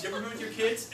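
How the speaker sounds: ordinary talk, nothing marked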